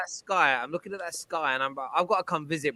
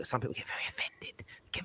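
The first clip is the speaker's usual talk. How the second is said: breathy mocking whisper, far softer; 3 semitones lower, 14 dB quieter than usual. Host teasing touchy fans, whispered. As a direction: whisper it as a sly aside. Mock aside about offended fans.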